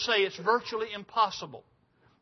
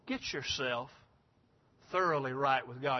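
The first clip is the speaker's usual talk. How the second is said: usual speaking voice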